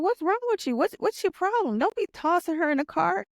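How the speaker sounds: high-pitched retold outburst; demanding answers in a high, heated voice; angry yell. Wife confronting husband tossing child in car, heated high yell.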